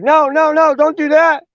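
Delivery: put-on high, urgent kid pleading; panicked kid. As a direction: beg him to stop, voice way up. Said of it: "And Ted's like".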